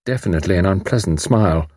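ordinary delivery

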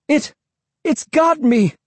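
very high, strained narrated scream; high-pitched yelp of terror, without full volume; panicked scream. A narrator's reading. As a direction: cry out in acted panic. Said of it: "I screamed".

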